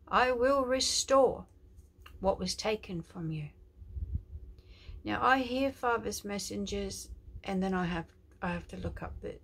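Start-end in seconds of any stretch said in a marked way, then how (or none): 0.1-3.5 slow, loud proclamation in God's voice; prophetic declaration. Acted. Preacher declares God's promise to viewers, loud and slow. Prophetic word spoken as God.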